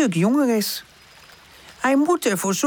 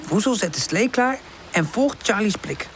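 usual speaking voice

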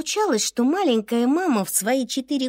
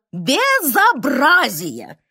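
slow, stern boss's outrage; stern expressive; 4 dB louder, much slower than usual. Acted. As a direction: draw out the word in strict reproach. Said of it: "с выражением говорила". (строго и с выражением)

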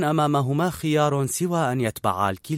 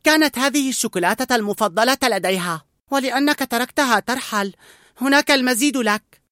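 high boy's voice, a hint of sniffling; sulking child with a mouthful of chocolate; mildly sulky, high child voice; 11 semitones higher, 4 dB louder than usual. A narrator's reading. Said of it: "وهو يشهق ويمضغ قطعة حلوى".

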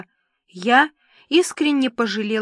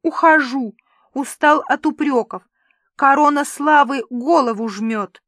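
loud, higher, self-pitying; tearful complaint; 5 semitones higher, 6 dB louder than usual. A narrator's reading. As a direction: declare it as a wounded star. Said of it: "всхлипнул Карлуша". (обиженно, громко жалуясь)

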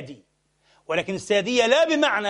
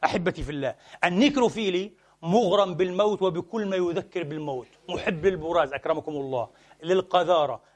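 normal talking voice

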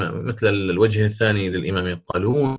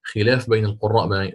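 ordinary delivery

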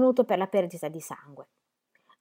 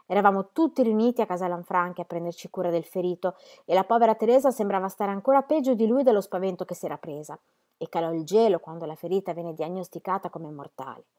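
ordinary delivery

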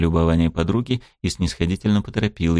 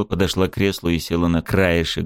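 ordinary delivery

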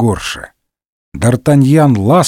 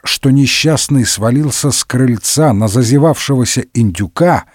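plain reading voice

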